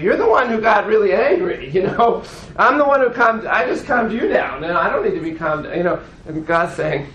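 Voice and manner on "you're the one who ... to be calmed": higher, louder indignant comeback; indignant protest. Acted. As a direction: protest hotly, pitched up at the start and easing off. Moses protests back to God.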